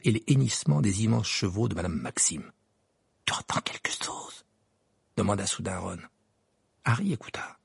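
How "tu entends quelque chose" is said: soft voiceless whisper. Acted. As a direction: whisper into the silence. Ron whispers in silence.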